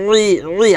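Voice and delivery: রি as a throaty ayn plus long i; a tight pharyngeal ayn in place of the r in রি; pressed ayn. Shown as a demonstration. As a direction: squeeze রি from mid-throat as ayn. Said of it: "আইনটা চাপ দিয়ে বলতে হবে গলার মাঝখান থেকে".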